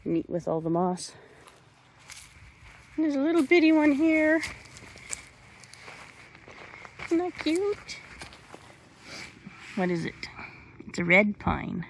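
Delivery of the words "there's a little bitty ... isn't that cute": cooing. Real, not acted. Cooing over a tiny seedling.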